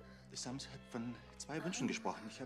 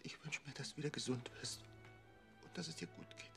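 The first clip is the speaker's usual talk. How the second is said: soft, lower, desperate pleading; tender pleading; 3 semitones lower, 11 dB quieter, slower than usual. Acted. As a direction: beg softly over a sick child. Father pleads over sick Sams.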